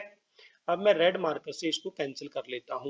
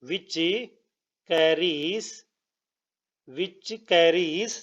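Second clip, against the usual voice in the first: drawn out, word by word; slow dictation; much slower than usual. Real, not acted. Teacher repeating words as students write, slow dictation. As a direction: pause and stretch as if writing along. Dictates statement while writing it.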